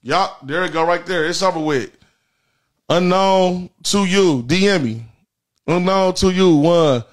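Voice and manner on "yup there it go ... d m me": normal talking voice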